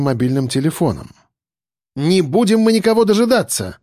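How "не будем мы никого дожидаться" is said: sharp, high angry refusal; angry outburst. A narrator's reading. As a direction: snap back in anger. Akulina snaps at notary.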